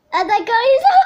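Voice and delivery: bursts into loud laughter mid-sentence; can't finish for laughing. Real, not acted. Girl laughing at her game screen, loud and high.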